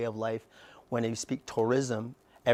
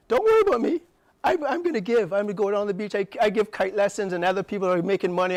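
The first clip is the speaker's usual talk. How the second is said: raised, higher defiant retort to the doubters; retort to critics; 6 semitones higher, 5 dB louder, faster than usual. Retold or imitated. Answering the critics who push back, in a loud defiant retort. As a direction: fire it back at them, voice up. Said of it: "so I say to the pushback".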